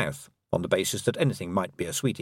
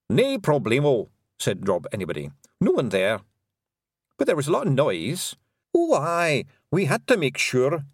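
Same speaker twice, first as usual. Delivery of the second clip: cheery Scots pixie, satisfied; breezy little Scotsman; Scottish Feegle voice; 5 semitones higher, 3 dB louder than usual. A narrator's reading. Feegles report back.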